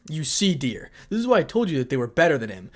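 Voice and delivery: ordinary delivery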